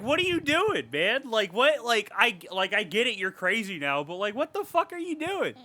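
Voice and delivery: high-pitched, incredulous questioning; baffled, exasperated mock confrontation; exasperated disbelief. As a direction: plead with a madman to explain himself. Exasperated mock address to the killer.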